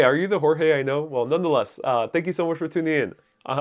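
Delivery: ordinary talk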